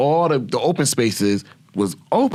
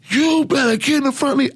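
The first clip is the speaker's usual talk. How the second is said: much higher put-on barking voice; drill sergeant. Sergeant calling out the private, high stern call. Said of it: "She said".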